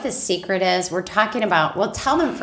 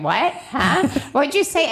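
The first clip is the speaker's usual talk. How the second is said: high, questioning mock confusion; rising, higher with each question; hard of hearing; 7 semitones higher than usual. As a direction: act out straining to catch what was said. Acts out hard-of-hearing spouses.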